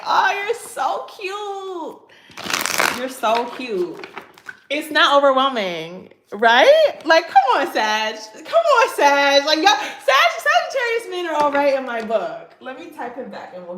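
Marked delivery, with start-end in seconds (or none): 0.1-3.0 very high, slow, laughing squeal of delight; gushing squeal. Real, not acted. Gushing to viewers about her date's text, high squeal. 4.7-12.6 loud, much higher hype shouts; excited cheering. Real, not acted. Cheering Sagittarius men to her viewers, loud and excited.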